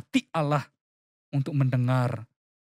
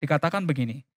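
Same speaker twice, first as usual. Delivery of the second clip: ordinary delivery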